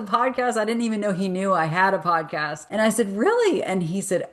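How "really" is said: jumps way up in pitch, amazed; a delighted, disbelieving squeak of a question; surprised really. Retold or imitated. Retelling her reply to the husband's compliment, high surprised.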